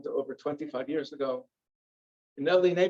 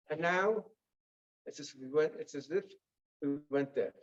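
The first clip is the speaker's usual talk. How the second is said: ordinary delivery, nothing marked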